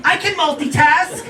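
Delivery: mock young woman's voice. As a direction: play her, unbothered and breezy. Girlfriend voiced.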